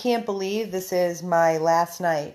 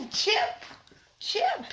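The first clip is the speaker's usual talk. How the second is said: talking to a dog; 14 semitones higher than usual. Real, not acted. Calling the dog high.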